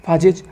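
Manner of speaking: "फाजेज" with a long ah, "faa-jez"; alternative pronunciation. Shown as a demonstration. Teacher showing students a third variant, "फाजेज" said as faa-jez. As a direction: open the first vowel of "फाजेज" to aa. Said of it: "कुछ scientist इसे फेज बोलते हैं".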